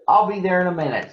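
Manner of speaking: quick, brisk detective reply; eager detective. Acted. Detective answering Ernie's call, quick and eager. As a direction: rattle it off like a keen sleuth. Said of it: "said Sherlock Hemlock".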